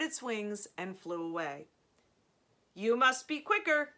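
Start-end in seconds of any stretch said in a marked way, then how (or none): 2.7-3.9 high, loud machine announcer; computer voice. A narrator's reading. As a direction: speak like a game computer. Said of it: "said the computer voice".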